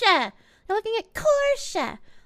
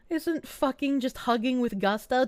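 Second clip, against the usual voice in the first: usual speaking voice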